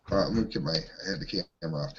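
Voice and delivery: ordinary talk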